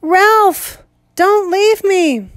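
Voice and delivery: high, slow pleading wail; high drawn-out wail. Acted. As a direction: wail it in terror. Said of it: "Piggy wailed".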